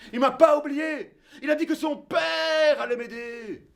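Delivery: "Il m'a pas oublié" crié haut et fort; éclate de joie; joie éclatante. Acted. ("Il m'a pas oublié" clamé, aigu et fort)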